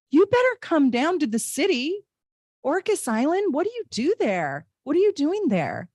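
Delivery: high, gushing questions; excited. Real, not acted. Host thrilled a viewer lives nearby, high and excited. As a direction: pepper the viewer with delighted questions.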